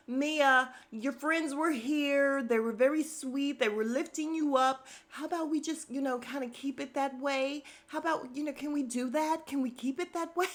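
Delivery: high, sing-song coaxing, lightly mocking; imitated pleading peacemaker. Castmate begs the group to keep it sweet, mock pleading.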